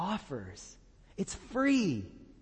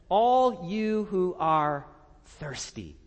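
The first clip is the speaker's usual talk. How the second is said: slow invitation; 3 semitones higher, 4 dB louder, much slower than usual. Acted. Quoting God's invitation.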